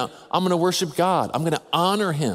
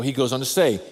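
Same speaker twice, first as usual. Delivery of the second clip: ordinary talk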